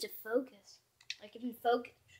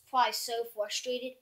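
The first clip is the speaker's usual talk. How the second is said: normal talking voice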